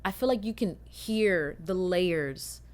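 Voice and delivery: normal talking voice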